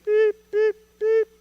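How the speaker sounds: high, loud mock beeping; scanner beep. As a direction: chirp like a checkout scanner. Cashier scans without answering.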